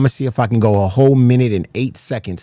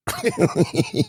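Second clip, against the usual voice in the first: laugh; 9 semitones higher than usual. Real, not acted. Laughs after meth joke.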